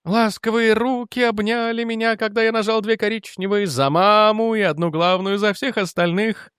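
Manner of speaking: high, sustained dreamy singsong; blissful madman. Acted. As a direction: croon it high, lost in a delusion. Mad lieutenant in his delusion. (высоко, нараспев, в блаженном бреду)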